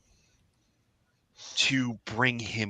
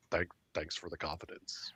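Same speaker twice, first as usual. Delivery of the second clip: sarcastic whispered thanks; dry whisper; 6 semitones lower, 12 dB quieter than usual. Acted. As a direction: mutter it dryly under breath. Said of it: "he goes".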